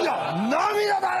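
slow, high tearful cry-out; mock tearful protest. Masseuse insisting they are tears, high and slow. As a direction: wail it through mock tears. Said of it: "おばちゃんが泣き出して".

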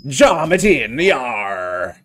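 hearty pirate laugh and cheer. Acted. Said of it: "my amazing pirate voice".

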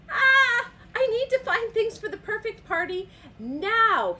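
high, loud, frantic wail; panicked outburst. A narrator's reading. Toucan needs things for the perfect party, panicking loudly. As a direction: burst out in storybook panic.

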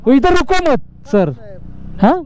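high-pitched, agitated pleading with the officer; heated protest. Real, not acted. Arguing with a traffic officer. (ऊँची आवाज़ में झुंझलाकर अफ़सर से बहस)

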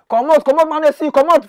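a high, loud "comot" to chase him off; mock shout. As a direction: yell at him to clear off. Shooing the president away.

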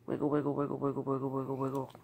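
very low, soft repetitive patter; low playful murmur. Real, not acted. Coaxing her dog in singsong.

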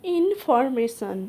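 Information drawn out very slowly, syllable by syllable; slow model pronunciation. Shown as a demonstration. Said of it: "সেটি হলো". (ধীরে ধীরে শব্দটা উচ্চারণ)

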